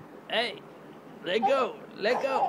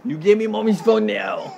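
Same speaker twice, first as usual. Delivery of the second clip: louder, higher playful scolding; mock stern; 4 semitones higher, 7 dB louder than usual. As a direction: pretend to be stern with toddler. Mock stern demand for phone.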